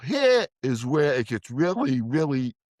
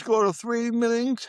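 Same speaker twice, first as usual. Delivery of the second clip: much higher, a light take on a firm counselor; quoting Deb; 10 semitones higher than usual. Retold or imitated. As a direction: voice the counselor giving orders, pitched up. Quoting Deb's firm advice.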